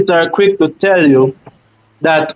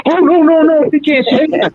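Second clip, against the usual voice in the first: much higher-pitched mock protest; protesting teenage girl; 9 semitones higher than usual. Teenage girl waving away the older man's worry, high-pitched imitated protest. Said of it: "She go".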